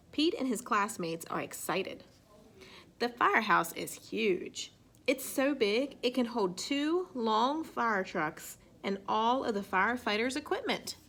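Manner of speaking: ordinary delivery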